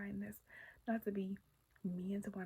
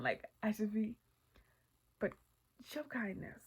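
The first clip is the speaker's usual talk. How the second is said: normal talking voice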